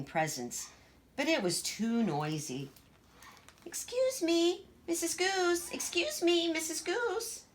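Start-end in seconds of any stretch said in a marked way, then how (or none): 3.6-7.4 put-on small, timid little girl voice; Gracie, shy child. Shy little Gracie asking her sister, timid.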